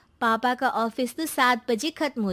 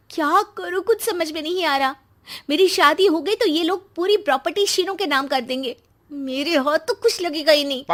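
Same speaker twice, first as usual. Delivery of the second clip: fretting to herself; 6 semitones higher, 3 dB louder than usual. Acted. Srishti scheming to herself.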